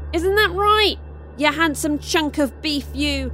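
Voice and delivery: teasing shout. Acted. Zuri teasing Austin about his looks, a playful shout. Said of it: "Zuri shouted towards Austin".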